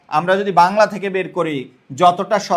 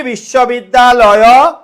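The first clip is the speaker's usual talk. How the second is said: বিশ্ববিদ্যালয় said slowly and a little louder; slowly spoken; 2 semitones higher, 5 dB louder, much slower than usual. Shown as a demonstration. Teacher modelling how we actually say it. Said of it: "আমরা কি বলি?".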